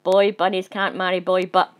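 loud, ranting bug; the stink bug goes on booming. Acted. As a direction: keep booming the rules at them. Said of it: "boomed the stink bug".

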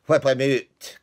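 furious guest ordering the dog out; angry shout. Acted. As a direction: yell it at the servants. Third diner shouting.

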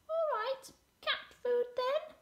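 very high, mewing cat voice; high kitty voice at normal volume; meowing cat. A narrator's reading. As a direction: meow it as a grudging cat. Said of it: "meowed Mildred".

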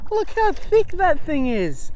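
high, slow, delighted cooing at the shell; thrilled cooing. Real, not acted. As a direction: coo over it like a treasure. Marvelling at the shell.

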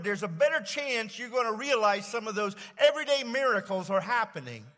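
loud, high preacher voice; preaching. Acted. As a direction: preach it out with fervor. Preaching at full force.

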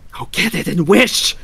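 high, loud, urgent cry; quivering with excitement. A narrator's reading. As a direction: urge him on, trembling with excitement. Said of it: "quivering with excitement".

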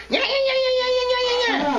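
high, mocking old lady yeah yeahs. Liquor store old lady taunts the young man, high mocking. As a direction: needle him in a high mocking old woman voice.

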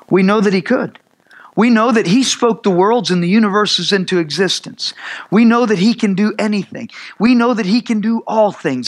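rising, driving preacher's build; fervent pulpit preaching. Acted. Preaching build on God's power.

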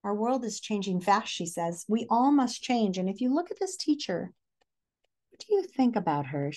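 plain reading voice

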